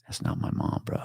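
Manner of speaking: hushed, eerie whisper; whispered confession. Retold or imitated. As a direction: confide it under the breath. Kid disowns his mother, retold.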